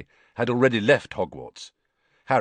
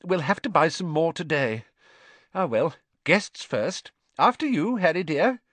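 higher motherly voice, bright and kind; higher fussing mother voice; Mrs Weasley, motherly; 8 semitones higher, 4 dB louder than usual. A narrator's reading. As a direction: coax him like a fussing mum. Mrs Weasley offering powder.

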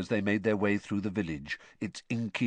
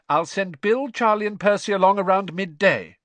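high, loud, brisk arrangement; raised motherly voice; calling mother; 10 semitones higher, 7 dB louder than usual. A narrator's reading. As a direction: call out plans to a departing husband. Mother calls plans to departing husband.

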